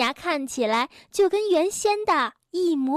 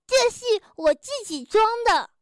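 proud; 7 semitones higher than usual. A narrator's reading. The doctor shows off his own gold tooth, proudly. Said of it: "海狸尾巴医生自豪地说".

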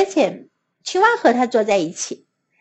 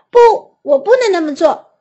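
higher, louder shouted refusal; 5 semitones higher, 4 dB louder than usual. A narrator's reading. Toad refuses Frog's plan, higher louder shout. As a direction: cry out the refusal. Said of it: "癞蛤蟆喊道".

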